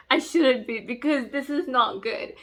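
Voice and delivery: usual speaking voice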